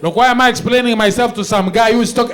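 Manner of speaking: ordinary talk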